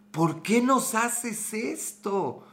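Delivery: slow, stretched-out despairing plea; anguished plea. Retold or imitated. Frustrated fan repeating his plea to the team, slow and despairing. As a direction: moan it like the team broke your heart. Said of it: "terminaba gritando cosas como".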